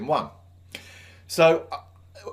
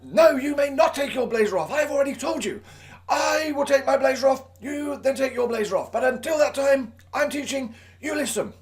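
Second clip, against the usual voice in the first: shouting at the pupil; 11 semitones higher than usual. Retold or imitated. Young teacher to pupil about his blazer, stern high shout. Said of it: "so I shouted at him".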